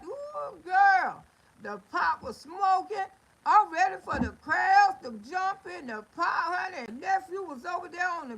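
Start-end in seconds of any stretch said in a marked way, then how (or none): 0.1-6.8 loud, high and eager, relishing the party; excited storytelling. Real, not acted. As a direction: belt it out like a hyped-up aunt ready to party. Story of aunt's cookout.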